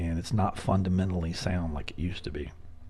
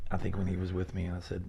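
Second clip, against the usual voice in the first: ordinary talk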